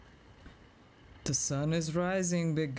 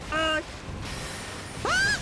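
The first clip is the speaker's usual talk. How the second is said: pained yelp; 13 semitones higher than usual. Real, not acted. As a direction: yelp as you get hit. Getting hit.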